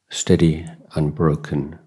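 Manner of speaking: ordinary talk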